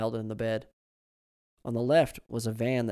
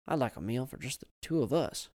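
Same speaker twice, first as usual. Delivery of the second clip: ordinary delivery, nothing marked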